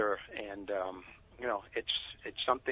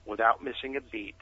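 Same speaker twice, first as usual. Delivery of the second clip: ordinary talk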